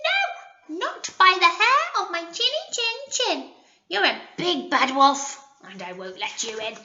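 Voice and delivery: high little pig, fading at the end. Acted. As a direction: refuse him like a small pig. Pig answers the wolf.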